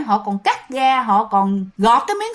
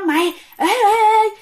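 panicked alarm; 5 semitones higher than usual. Retold or imitated. Cousin warning him about a figure on the tombstone, frightened. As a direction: yelp in fright at the thing on the grave.

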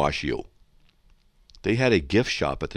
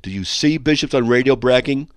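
"Do you see bishops on radio bragging" quoted higher and louder; pointed requote; 6 semitones higher, 8 dB louder than usual. Retold or imitated. Host quoting a listener's question back, pointed requote.

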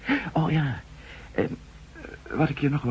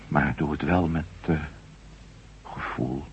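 timid plea; 7 semitones lower, 4 dB quieter than usual. Acted. Timid plea about injections.

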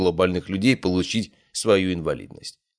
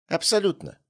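high, eager yes; eager agreement; 11 semitones higher than usual. Acted. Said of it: "закивал Коршунов".

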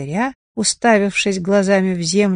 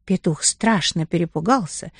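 plain reading voice

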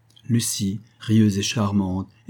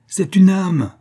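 high, loud exasperated cry; desperate cry; 10 semitones higher, 7 dB louder, slower than usual. A narrator's reading. Rector crying to his deaf mother, desperate. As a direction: cry it out at your wits' end. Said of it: "cria le recteur aux abois".

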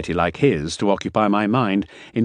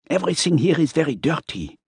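higher character voice, complaining; 9 semitones higher than usual. Acted. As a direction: grumble it in a higher voice. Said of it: "he complained".

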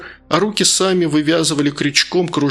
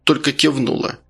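ordinary delivery, nothing marked

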